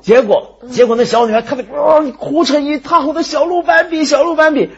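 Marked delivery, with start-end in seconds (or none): 1.7-4.7 put-on high wailing child's sob; crying little girl. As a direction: wail like a heartbroken little girl.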